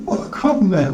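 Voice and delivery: high-pitched nephew, taken aback; cheerful nephew, protesting. Acted. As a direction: protest in a high, youthful character voice. Nephew's voice in the story.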